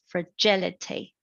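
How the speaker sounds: fraGIlity, weight on the second syllable; second-syllable stress. Shown as a demonstration. Student shows stress in a polysyllabic word, second-syllable stress. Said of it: "the stress is on the second syllable".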